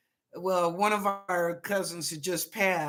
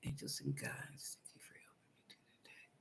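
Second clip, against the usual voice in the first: whispered prayer; 25 dB quieter, faster than usual. Real, not acted. Psychic calling on her guides.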